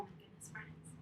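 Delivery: breathless hushed whisper. Acted. Teacher to kids after spotting bear, whispered. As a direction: whisper in mock fright.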